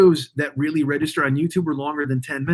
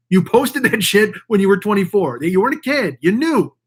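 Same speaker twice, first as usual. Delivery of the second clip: ordinary talk, nothing marked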